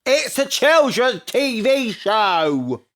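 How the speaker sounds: loud, high, drawn-out protest; exasperated shout. Real, not acted. Fan defending a children's show, exasperated shout.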